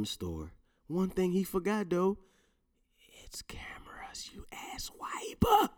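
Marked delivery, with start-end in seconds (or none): 3.2-5.7 hushed, slow mocking whisper; slow breathy taunt. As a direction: whisper the insult slowly like a secret. Mock whisper taunt at arsonist.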